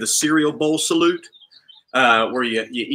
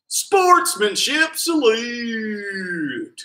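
drawn-out shout; 6 semitones higher, much slower than usual. Acted. Climax of a salute list.

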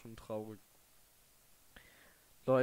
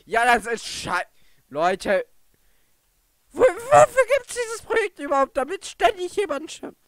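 furious shout; 13 dB louder than usual. Real, not acted. Furious about character deaths.

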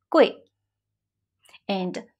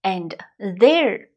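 usual speaking voice

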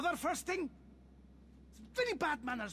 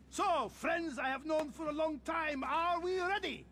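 rallying shout; 4 semitones higher than usual. Acted. Host calling his friends to leave for the party, slow excited shout. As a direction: hype up the crowd, leaning on each word.

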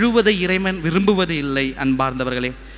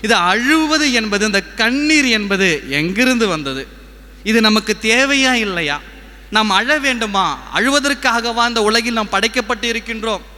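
preaching; 4 semitones higher than usual. Acted. Preacher asks congregation where tears come from, preaching. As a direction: put the questions from the pulpit. Rhetorical questions in sermon voice.

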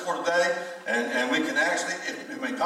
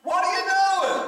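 much higher, louder put-on kid voice; high mock child; 12 semitones higher, 4 dB louder than usual. Grandchild questioned mid-selfie, high mock child voice. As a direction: ask it like a startled child.

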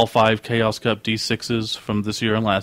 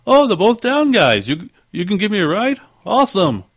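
much higher, excited guest; super happy; 10 semitones higher, 4 dB louder than usual. Acted. Guest asking podcasters for a ride, super happy. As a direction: gush like a thrilled new passenger. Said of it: "he was just super happy".

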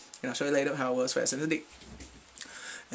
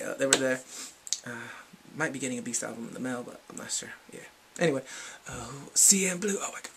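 ordinary delivery

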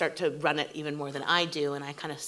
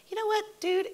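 much higher, scoffing "You know what, dude"; indignant retort. Retold or imitated. Writer retells her reaction to a bullying showrunner, indignant high retort. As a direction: call out the bully. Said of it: "I was like".

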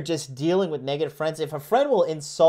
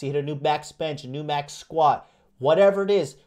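ordinary talk, nothing marked

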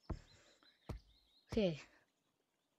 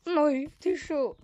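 voice crack; 6 semitones higher, 6 dB louder, much slower than usual. Real, not acted. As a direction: voice cracking high. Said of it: "that voice crack".

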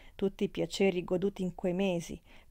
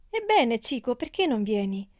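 louder, higher surprised shout; amazed call; 4 semitones higher, 5 dB louder than usual. Acted. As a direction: call down to him in genuine wonder. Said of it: "gridò sinceramente meravigliata".

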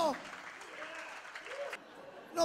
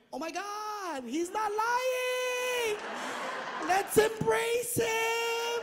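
higher, slow, gushing sing-song; gushing mock; 7 semitones higher, much slower than usual. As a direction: swoon over the son, overdone.

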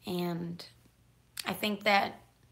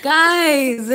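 excited shout; 7 semitones higher, 15 dB louder than usual. Real, not acted. Dog interrupts.

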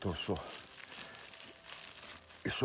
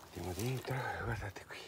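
whispering; 7 dB quieter, slower than usual. Real, not acted. Whispering over a mushroom.

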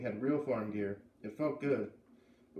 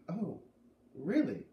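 surprised. Acted. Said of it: "Beat clapped her hand to her mouth".

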